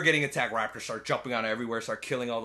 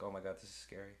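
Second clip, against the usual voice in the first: hushed fright; 4 semitones lower, 15 dB quieter, faster than usual. Retold or imitated. Girl covers her mouth.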